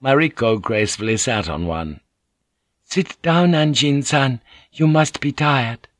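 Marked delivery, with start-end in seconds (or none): 2.9-5.9 Mariko's voice. Acted. Mariko greets Blackthorn, higher courteous lady's voice.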